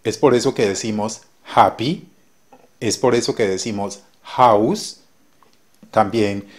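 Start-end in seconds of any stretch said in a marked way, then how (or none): 1.5-2.1 happy with a rasping Spanish J at the start, xappy; English H like J. Shown as a demonstration. Teacher showing Spanish speakers the English H, throaty J. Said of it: "el sonido de la H suena como J". 4.2-5.0 house with a rasping Spanish J at the start, xouse; English H like J. Shown as a demonstration. Teacher showing Spanish speakers the English H, throaty J. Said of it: "el sonido de la H suena como J".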